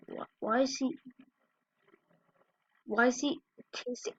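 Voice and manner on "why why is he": normal talking voice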